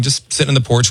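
usual speaking voice

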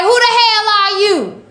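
high, loud, confrontational bark; tough girl challenge. Retold or imitated. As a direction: get in his face, mock tough. Ugly girl confronting her.

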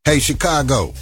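normal talking voice

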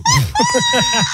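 high burst of laughter. Real, not acted. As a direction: crack up laughing. Laughing at own joke.

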